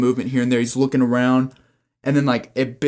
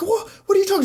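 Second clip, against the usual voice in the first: high panicked squeal; much faster than usual. Acted. Terrified boyfriend facing the hunter, high panicked squeal. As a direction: squeal it in terror. Screaming man confronted by hunter.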